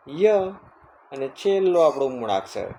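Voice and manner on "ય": ય as a high, loud "yuh"; letter-sound demo. Shown as a demonstration. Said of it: "વાયનો ઉચ્ચાર થાય". (ય નો ઊંચો, મોટો ઉચ્ચાર)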